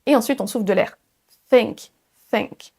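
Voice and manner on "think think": careful th in think; correct TH. Shown as a demonstration. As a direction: think with an airy, tongue-out th. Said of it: "la bonne prononciation du son T H".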